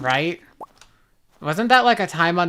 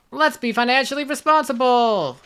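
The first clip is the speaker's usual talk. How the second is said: meme catchphrase; 6 semitones higher than usual. Retold or imitated.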